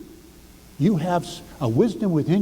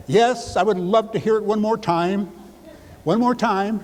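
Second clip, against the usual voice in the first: eager wife; 6 semitones higher than usual. Acted. Wife asking to hear it.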